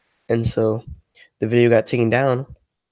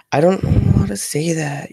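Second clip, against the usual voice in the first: cute high voice; 6 semitones higher than usual. Pretend. Chat teases, playful complaint.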